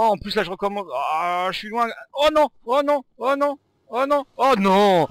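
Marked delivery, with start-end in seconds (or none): 2.1-5.1 high, loud repeated oh no; loud groans of dismay. Real, not acted. Gamer losing again and again, loud repeated dismay. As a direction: wail in frustration each time.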